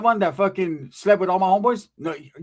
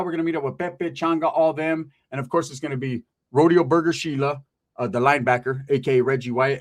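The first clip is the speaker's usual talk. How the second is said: ordinary talk, nothing marked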